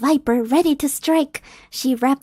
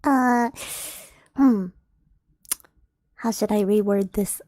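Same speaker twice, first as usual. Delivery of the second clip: ordinary delivery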